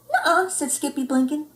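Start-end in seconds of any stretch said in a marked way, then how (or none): 0.1-0.5 higher, playful little kitty denial; innocent denial. Acted. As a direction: deny it all innocently. Skippy denying, high.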